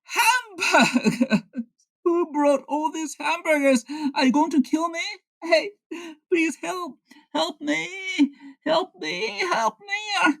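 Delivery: high, panicked cries for help; terrified. Pretend. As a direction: beg for help in high terror. Henry wakes among feared hamburgers.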